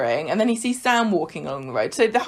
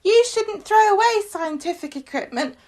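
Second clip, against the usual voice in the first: put-on much higher, know-it-all little boy; prissy child voice; 12 semitones higher than usual. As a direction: lecture like a nerdy kid. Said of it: "He goes".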